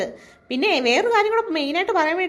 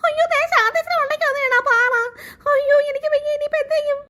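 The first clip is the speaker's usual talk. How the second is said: panicked cry; 6 semitones higher than usual. Acted. Rock fell on friend's head.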